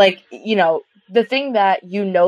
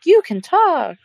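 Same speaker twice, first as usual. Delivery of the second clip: surprised; 10 semitones higher, much slower than usual. Retold or imitated. Animal's talking revealed in a show.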